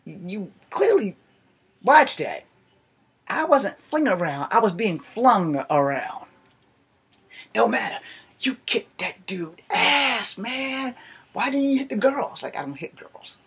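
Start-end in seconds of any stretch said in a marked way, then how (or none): 0.0-6.3 high, slow, pointed retort; indignant correction. Retold or imitated. To the coworker who watched, an indignant slow retort. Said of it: "I'm like". 7.5-12.4 high, amazed big-guy voice; impressed coworker. Retold or imitated. Coworker Chris praising the fight, high and amazed. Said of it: "coworker keeps going".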